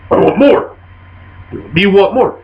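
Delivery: short, simple demands in a hint of ogre; simple-minded ogre. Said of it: "the ogre".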